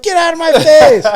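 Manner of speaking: very high, loud shout; yelling deputy. Retold or imitated. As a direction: imitate a DI screaming at a recruit. Retold deputy yelling at recruit.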